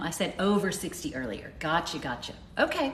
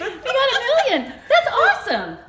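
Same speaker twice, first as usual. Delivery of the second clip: much higher, louder, with a laugh; laughing excitedly; 5 dB louder than usual. Real, not acted. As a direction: squeal it out laughing. Million likes reached.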